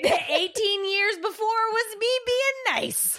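high, slow, laughing through the words. Real, not acted. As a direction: giggle out the line. Laughing at the spat.

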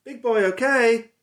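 high, loud sing-song call; a slow sing-song call to the dog. Real, not acted. Calling to the dog.

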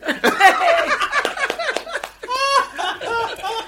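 long, loud, high-pitched laugh; helpless laughing. Real, not acted. Joke teller after his own punchline, helpless laughing. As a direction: burst out laughing at length.

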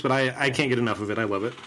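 ordinary talk

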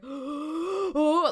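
high, loud gasped oh; big retold gasp of awe; awestruck reaction. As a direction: re-enact getting chills, gasping. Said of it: "like, you know, chills".

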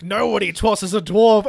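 hammed-up Gimli refusing to be thrown; proud dwarf. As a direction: declare it slowly and loudly, offended pride. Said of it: "he's like".

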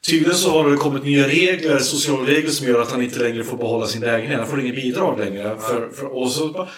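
ordinary talk

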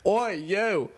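high Cockney call; mock Cockney street cry; Cockney accent. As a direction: holler like a Cockney sweep. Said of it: "put on the accent".

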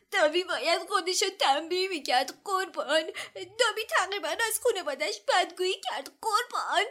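high, eager elf voice; high squeaky servant elf, pleading; house-elf voice. A narrator's reading. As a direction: play the anxious, servile house-elf. Narrator voices Dobby.

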